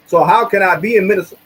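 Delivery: loud, high-pitched exasperated demand; retold indignant challenge. Telling the bank off over Minnesota, indignant. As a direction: throw it at them, loud and incredulous.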